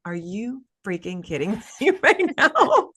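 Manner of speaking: disbelieving husband, laughing through it; stunned husband. Retold or imitated. Husband hearing it's twins, slow stunned laughing disbelief. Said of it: "my husband was just like".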